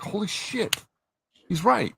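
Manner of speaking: suddenly struck by the obvious; stunned realization. Retold or imitated. Fan retelling his reaction to a review, startled realization. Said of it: "And I was like".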